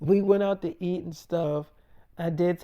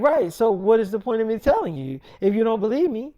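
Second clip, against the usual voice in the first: higher, louder exasperated arguing; acted retort; 5 semitones higher, 6 dB louder than usual. Daughter to doubting mother, acted exasperated retort. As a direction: play a daughter arguing back.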